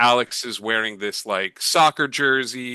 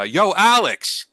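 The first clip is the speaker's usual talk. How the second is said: loud, drawn-out call across the room; 8 semitones higher, 6 dB louder, slower than usual. Acted. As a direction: hail a friend from afar. Calling someone across a room.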